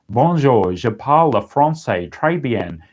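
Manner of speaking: a hint of a bad French accent; mock bad French accent. Learner showing off bad French. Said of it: "they actually think that that sounds like a French person".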